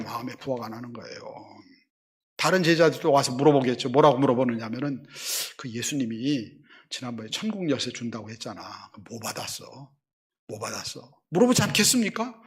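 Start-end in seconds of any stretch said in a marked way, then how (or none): 0.0-1.2 soft echo. Acted. Quiet echo repeat. 5.6-11.0 hushed question. Acted. Disciples asking Peter.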